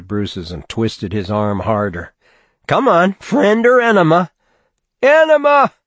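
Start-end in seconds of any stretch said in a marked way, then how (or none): 2.7-4.3 taunting. A narrator's reading. Chet taunting pinned Bruce. 5.0-5.7 much higher, loud scream. A narrator's reading. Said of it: "Bruce screamed".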